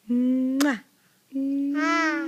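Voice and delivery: smacking kisses; kiss sounds. Shown as a demonstration. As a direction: kiss the doll out loud. Mother kisses the doll.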